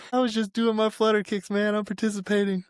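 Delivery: high-pitched mock self-defence; acted pleading excuse. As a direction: plead your case high, acting it out.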